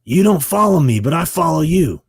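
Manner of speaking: high, loud actor impression; Robert Patrick. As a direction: call out to a half-remembered writer. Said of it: "He goes".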